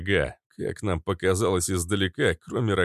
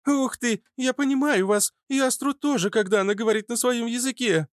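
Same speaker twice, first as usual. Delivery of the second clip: put-on much higher, delighted young girl; amazed girl voice. A narrator's reading. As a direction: gasp it out in wonder. Fitilyok amazed.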